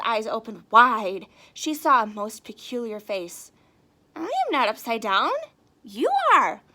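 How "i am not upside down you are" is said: Stellaluna insists. Acted. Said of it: "Stellaluna said".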